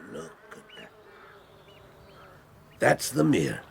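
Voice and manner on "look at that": barely voiced whisper; hushed pointing-out. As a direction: whisper it so nothing gets disturbed. Pointing out wildlife on walk.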